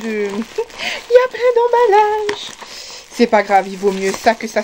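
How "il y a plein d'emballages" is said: high, drawn-out squeal through laughter; real giggly high-pitched outburst; laughing. Real, not acted. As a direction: giggle helplessly through the words, much higher. Cracks up at messy packaging.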